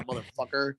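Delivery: ordinary talk, nothing marked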